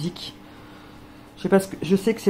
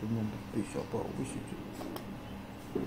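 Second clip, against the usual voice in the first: soft coo; 6 semitones lower, 11 dB quieter than usual. Real, not acted. Owner murmuring to her dog, fading to a hush. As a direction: murmur tenderly to the pup.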